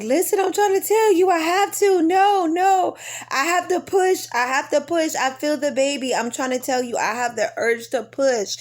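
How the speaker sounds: high, urgent plea breaking into panic; desperate pleading. Retold or imitated. Mother in labor begging doctor to let her push, desperate high plea. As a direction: beg them, it's coming now.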